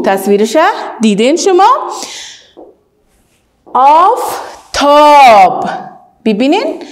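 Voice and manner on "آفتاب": slow, drawn-out word, sounding out the long a; stretched vowel. Shown as a demonstration. Teacher showing pupils how to draw out the vowel. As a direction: hold the first vowel long. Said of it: "شما اول آوازش میکشین".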